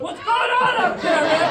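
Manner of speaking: high, lightly voiced curious dog. Comic voicing his dog asking from the back seat, high curious voice. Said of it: "He's going".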